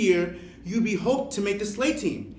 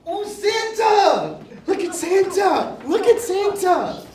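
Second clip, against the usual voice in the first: excited about Santa; 8 semitones higher than usual. Acted. Child calling out on seeing Santa. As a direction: gasp and point at Santa.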